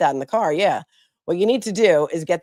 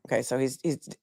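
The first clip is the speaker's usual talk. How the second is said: ordinary talk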